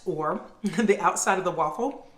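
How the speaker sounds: ordinary talk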